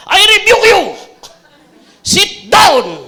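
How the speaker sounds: high, loud overdone scolding, laughing; hammed-up rebuke. Shown as a demonstration. Parent yelling at a running child, hammed-up. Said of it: "Ganito ba?".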